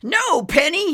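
high, loud protest; scream. A narrator's reading. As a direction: scream at the dog to stop. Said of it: "he screamed".

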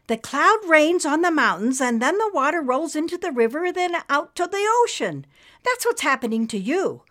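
put-on high, loud young girl teaching eagerly; excited girl explaining the cloud diagram. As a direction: explain it like a kid showing a picture.

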